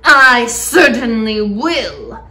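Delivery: loud, drawn-out angry reply; emphatic, vengeful aunt; snapped. A narrator's reading. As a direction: snap it back with menace. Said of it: "Aunt Spiker snapped".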